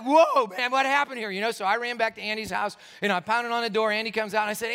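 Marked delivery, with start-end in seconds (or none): 0.0-1.3 high, loud, astonished outburst; excited amazement. Retold or imitated. Teenager amazed by brother's healing, loudly excited. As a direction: react wildly, the opposite of calm. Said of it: "that's not how I was".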